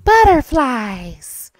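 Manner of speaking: imitated soft, sighing poem voice. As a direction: stretch it out softly like a delicate child reciting. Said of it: "her voice was so soft".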